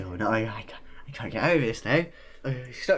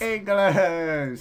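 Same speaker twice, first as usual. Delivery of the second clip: shout; 6 semitones higher, 4 dB louder than usual. Real, not acted. Shouting guess at reveal.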